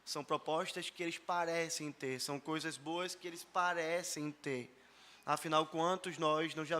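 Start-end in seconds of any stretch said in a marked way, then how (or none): 0.0-2.2 "parecem" stretched out for emphasis; stress on PARECEM. Real, not acted. Preacher warning about politicians' proposals, leaning hard on "parecem". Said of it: "chamar a sua atenção para essa palavra". 2.2-4.8 "parecem" emphasised and lengthened; stress on PARECEM. Real, not acted. Preacher repeating the point about good things, stressing "parecem". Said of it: "chamar a sua atenção para essa palavra".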